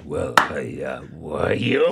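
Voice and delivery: Red imitation. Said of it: "And he was like".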